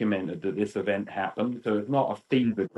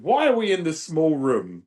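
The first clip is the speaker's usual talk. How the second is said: higher-pitched indignant question; imitated indignant complaint; 7 semitones higher, 3 dB louder than usual. Convention guest complains to the organiser about the room, imitated indignant question. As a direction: protest it like an affronted guest.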